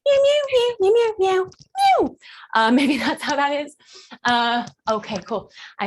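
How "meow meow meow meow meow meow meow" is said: very high, tiny mewing; little kitten. Acted. Coach modelling a kitten strut, high little mews. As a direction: mew along like a kitten. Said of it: "a little kitten strut".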